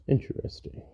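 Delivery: unvoiced, under-the-breath whisper; whispered musing. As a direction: breathe the word out to yourself. 18 musing to himself.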